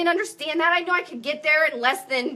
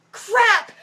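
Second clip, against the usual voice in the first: a louder, higher cry of dismay; higher-pitched, startled outburst; sudden alarm; 5 semitones higher, 4 dB louder than usual. Acted. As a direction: blurt it out in mock panic. Acting out panic at falling plate.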